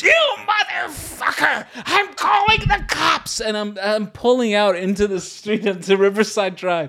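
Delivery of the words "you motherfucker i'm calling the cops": slow, high-pitched mock shriek of outrage; imitated screaming. Imitating woman threatening to call the cops, high mock scream. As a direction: screech like an enraged woman, drawn out. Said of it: "looking at her screaming".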